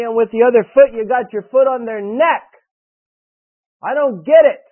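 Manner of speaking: high, loud, exasperated preaching; upset preaching. Real, not acted. Father preaching to defiant kids, loud and exasperated. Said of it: "I'm really upset about it".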